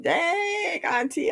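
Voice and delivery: put-on high, startled kid voice. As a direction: imitate the nieces caught off guard. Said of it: "They be like".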